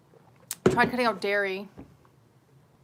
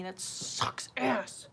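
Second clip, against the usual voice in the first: slow, whispery dejection; hushed, drawn-out lament; whispered complaint; 8 dB quieter, much slower than usual. Real, not acted. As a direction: drag it out under your breath.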